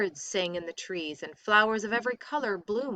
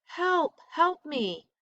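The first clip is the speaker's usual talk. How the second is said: high cry from far off; distant cry for help; 9 semitones higher, slower than usual. A narrator's reading. Rama cries out from the woods, high and far. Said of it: "heard a cry".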